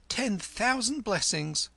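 high, slow, ringing cry of thanks; shrill it out gratefully; a boy's high shrill cry. A narrator's reading. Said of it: "shrilled Kim".

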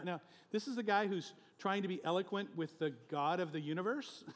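ordinary talk

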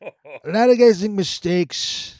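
high, loud, slow Trump voice; Trump impression. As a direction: do your Trump. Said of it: "a little Trump there".

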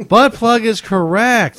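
high, loud, slow game-show reveal; excited announcement. Real, not acted. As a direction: announce the winner with glee. Host announces correct answer.

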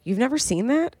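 high, disbelieving question; incredulous. Retold or imitated. Friend quizzing another about shows she missed, incredulous. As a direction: ask it in rising disbelief. Acting out her own surprise at friend.

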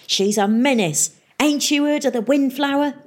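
pirate mayor. Acted. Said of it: "snarled Peavy".

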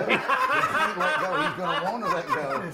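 high, loud, drawn-out relish; loud, delighted troublemaker; gleeful scheming. Retold or imitated. Gleefully plotting against racist grandpa.

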